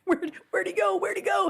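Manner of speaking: high-pitched mock searching cries; panicked searchers. Boys who couldn't find Cass, high mock panic. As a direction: play frantic boys who lost someone.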